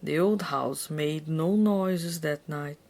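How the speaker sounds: usual speaking voice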